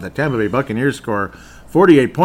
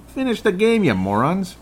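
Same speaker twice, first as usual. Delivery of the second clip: exasperated yell; 8 semitones higher than usual. Real, not acted. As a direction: pitch jumps high on finish. Yelling at losing team.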